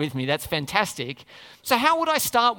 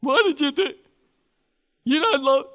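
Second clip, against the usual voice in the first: put-on child complaining; 7 semitones higher, 5 dB louder than usual.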